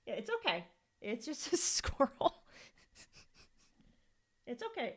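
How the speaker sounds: high, very soft reassurance; soothing a pet. Real, not acted. Podcaster calming a pet upset by a squirrel, soothing. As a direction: coo it gently to calm an animal.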